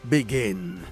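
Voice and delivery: plain reading voice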